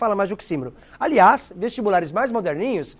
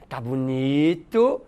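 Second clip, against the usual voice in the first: drawn-out sarcastic praise, slow and lower; mock admire a bad haircut slowly; slow ironic drawl; 4 semitones lower, 3 dB quieter, slower than usual. Teacher mocking a haircut with ironic praise. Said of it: "É a ironia".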